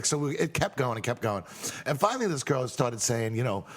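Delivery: ordinary delivery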